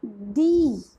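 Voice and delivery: दी with a long ee; long thee. Shown as a demonstration. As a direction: stretch दी into thee. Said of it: "the pronunciation of T H E will be".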